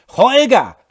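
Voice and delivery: piercing high scream right into his ear. A narrator's reading. Laura screams right into drunk Holger's ear. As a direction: scream it point-blank. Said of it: "Jetzt schrie sie ihm direkt ins Ohr".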